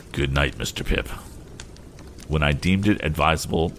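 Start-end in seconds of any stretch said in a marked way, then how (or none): 0.1-1.1 lower, hollow and numb; a hint of a hollow, defeated sigh. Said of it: "with a hollow voice".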